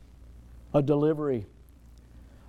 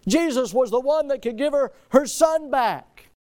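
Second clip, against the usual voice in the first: raised preaching; 8 semitones higher, 4 dB louder than usual. Real, not acted. Preaching climax.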